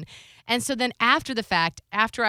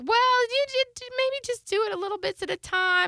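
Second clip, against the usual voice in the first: much higher, put-on voice imitating her mother; mocking mom voice. Daughter mimics her mother on the phone, mocking high voice. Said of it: "So my mom's like".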